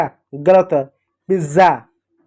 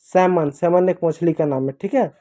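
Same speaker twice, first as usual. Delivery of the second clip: usual speaking voice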